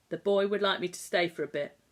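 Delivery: ordinary delivery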